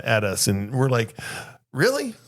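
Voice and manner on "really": high, disbelieving jab; incredulous really. Retold or imitated. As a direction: toss it back in mock disbelief. Crew reacting to rude passengers.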